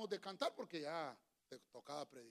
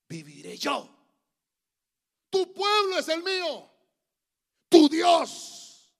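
Ruth; 10 semitones higher, 19 dB louder, much slower than usual. Acted. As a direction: proclaim each vow at full force, drawn out. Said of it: "Pero Ruth contestó". (votos proclamados a gritos)

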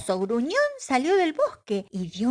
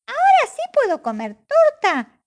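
much higher and louder, excited asking; eager child; 7 dB louder than usual. Acted. Pepe begs his mother for cake, high and excited.

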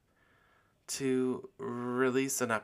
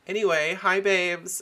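high, loud hi babes; perky greeting; 9 semitones higher, 9 dB louder than usual. Acted. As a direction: bounce back into host mode. Restarting the greeting.

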